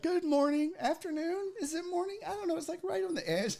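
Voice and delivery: put-on very high, soft girlish clerk voice; imitating a chirpy young receptionist; sweet young lady. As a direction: put on a tiny, sweet feminine voice. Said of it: "this sweet young lady".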